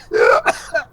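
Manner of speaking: cracked falsetto laugh; strained high laugh. Acted. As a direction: laugh that hurts the throat. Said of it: "That hurt".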